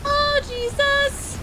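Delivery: high, slow panicked wail. Real, not acted. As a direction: stretch it out in rising panic. Scared streamer repeating in dread.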